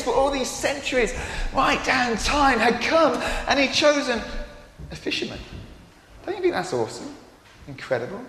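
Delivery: ordinary delivery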